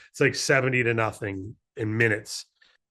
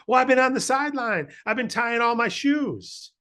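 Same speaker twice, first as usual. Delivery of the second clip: centipede voice; 10 semitones higher than usual. Acted. Centipede explaining why he was late, in a very high voice. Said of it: "And the centipede says".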